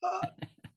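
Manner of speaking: high-pitched dry gasp; acted gasp for air; desperate gasp. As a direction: mimic gasping with a parched mouth. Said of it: "I couldn't even talk".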